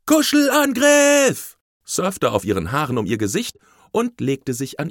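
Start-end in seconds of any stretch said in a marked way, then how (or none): 0.1-1.6 high, long-drawn shout; loud, stretched, high-pitched yell; playful battle cry. A narrator's reading. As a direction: holler it like a child charging in for a cuddle.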